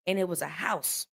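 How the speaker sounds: normal talking voice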